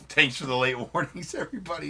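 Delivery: laughing sarcastic quote. Retold or imitated. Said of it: "he says".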